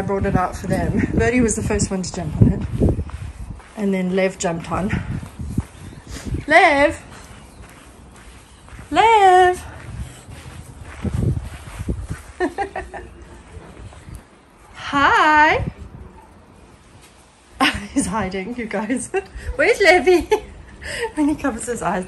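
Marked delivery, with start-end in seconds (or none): 6.5-15.7 calling out. Real, not acted. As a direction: call his name out to find him. Calling out to her son.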